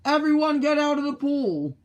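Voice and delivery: high, loud order to clear the pool; yelling. Acted. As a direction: shout over the swimmers. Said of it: "The firemen yelled".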